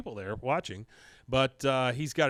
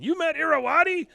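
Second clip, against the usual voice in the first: much higher, louder, gushing fan squeal; starstruck; 7 dB louder than usual. Retold or imitated. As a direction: squeal it like meeting your idol. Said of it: "Con is starstruck all of a sudden".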